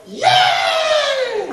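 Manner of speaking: high, loud cheer of "Yay!". Shown as a demonstration. Showing the group the closing cheer, a loud high "Yay!". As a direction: throw a big "Yay!" to the room. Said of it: "we're gonna go".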